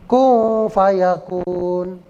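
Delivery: very slow "kun fayakun", n hummed into f; veiled ikhfa. Shown as a demonstration. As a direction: hold a blurred nasal n in KUN. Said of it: "dibaca samar".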